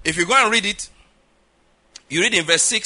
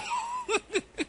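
amused laugh; 9 dB quieter than usual. Real, not acted. Laughs at his own story.

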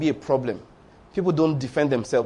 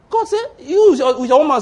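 preacher crying out; 9 semitones higher, 5 dB louder than usual. Real, not acted.

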